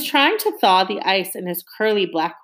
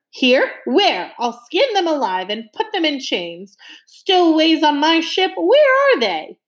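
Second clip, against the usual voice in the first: high, loud raging shout; furious captain; 8 semitones higher, 4 dB louder than usual. A narrator's reading. Captain hunting for stowaways, furious shout. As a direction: bellow the threat, higher each time.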